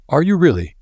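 high-pitched, keenly interested question; intrigued, pitch leaping up; new interest. A narrator's reading. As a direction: react with sudden fresh interest. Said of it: "with a new note of interest".

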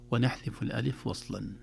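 normal talking voice

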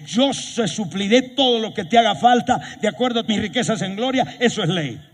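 high driving sermon delivery; fiery preaching. Acted. Preacher proclaiming God's law to the church, fiery preaching. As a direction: preach with full force.

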